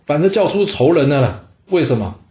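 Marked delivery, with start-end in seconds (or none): none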